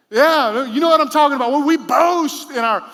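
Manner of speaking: high, loud emphatic call; loud preaching. Real, not acted. Preacher to crowd, loud excited call. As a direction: drive it home loudly.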